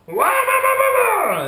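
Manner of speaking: loud high "wah-wah" motor noise; high, loud wheel-spin noise; imitated engine revving. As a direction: mimic a straining engine at full voice.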